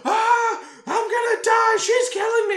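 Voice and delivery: high, loud hammed-up screaming; [name]. [name] still shouting on the escalator, panicked screaming. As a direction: shriek it in mock mortal panic. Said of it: "[name] continues to shout".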